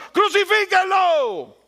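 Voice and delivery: high, loud, slow crowd yell; mob shouting. Retold or imitated. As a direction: hurl it like the angry mob. (la turba gritando con furia, alto y lento)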